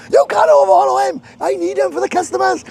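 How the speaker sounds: angry shout. Retold or imitated. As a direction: bark it like a furious boss. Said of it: "Craig went off his head".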